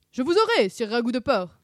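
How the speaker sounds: loud, high chasing cry; shouted threat. A narrator's reading. Sammy chases Scooby, shouting a mock-knightly threat. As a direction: shout it after a fleeing foe. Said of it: "cria Sammy en le poursuivant".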